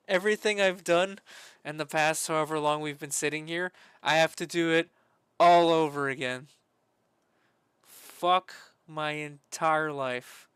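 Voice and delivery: loud exasperated complaint; loud frustrated rant. Real, not acted. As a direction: vent at the screen. Ranting over lost work.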